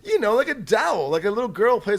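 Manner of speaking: high, loud mock explaining; imitating Waka. Waka explaining dolls to baffled players, insistent. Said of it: "He's like".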